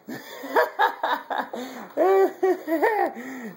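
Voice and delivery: a burst of real laughter after a fright; real startled laugh. Real, not acted. Dad laughing after the hooks scared him.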